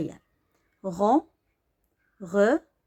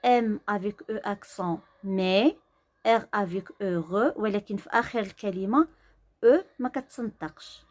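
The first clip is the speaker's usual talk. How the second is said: ordinary talk, nothing marked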